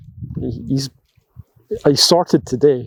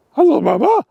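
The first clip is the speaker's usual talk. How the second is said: much higher, cutesy voice for the dog; dog's voice; 12 semitones higher, 4 dB louder than usual. Pretend. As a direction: speak for the dog in a squeaky little voice. Voicing the dog's greeting.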